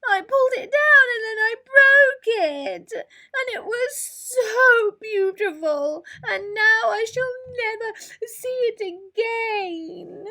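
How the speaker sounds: high, loud, slow wailing sobs; sobbing. A narrator's reading. Heartbroken Mole sobs to his friends, high and wailing. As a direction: cry it out, heartbroken over the moon. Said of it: "sobbed Mole".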